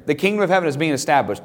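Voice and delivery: ordinary talk, nothing marked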